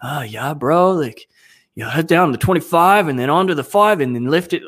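hammed-up Californian skit accent. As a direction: attempt a sing-song Californian accent. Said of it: "to do the Californians".

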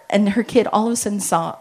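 ordinary talk, nothing marked

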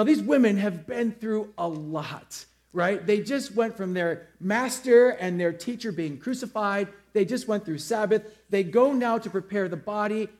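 normal talking voice

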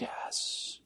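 breathy unvoiced whisper of assent. Listener at Bush's speech, whispering agreement. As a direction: barely breathe the yes, quietly moved.

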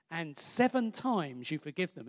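ordinary talk, nothing marked

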